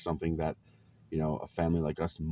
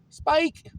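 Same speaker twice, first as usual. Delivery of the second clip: much higher, louder yelp of delight; excited cry; 7 dB louder than usual. Real, not acted. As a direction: shout the name like you just remembered it. Remembering favourite old game.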